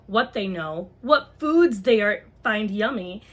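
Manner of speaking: ordinary talk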